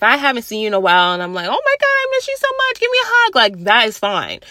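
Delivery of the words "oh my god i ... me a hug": high, fast gushing squeal; gushing affection. Acted. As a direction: gush it like greeting a missed friend. Gushing greeting to a close friend.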